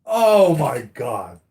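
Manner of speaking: slow, drawn-out "oh my God"; stunned disbelief. Real, not acted. Fan reacting to Thanos's cold answer, slow disbelief. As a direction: let the disbelief stretch out.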